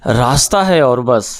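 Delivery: measured ghazal recital with held pauses; poetry recitation. Acted. Poet recites his teacher's ghazal at the literary meet, measured and deliberate. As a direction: lay out each line for the gathering.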